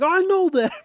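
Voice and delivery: squeaky, loud mock-child outburst; excited kid voice. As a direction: imitate your son shooting up very high. Imitates son suddenly understanding.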